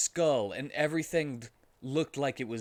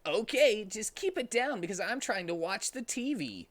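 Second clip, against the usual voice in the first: high, distracted mother; a mom busy with the TV; mom's voice; 10 semitones higher than usual. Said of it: "said Sonic's mom".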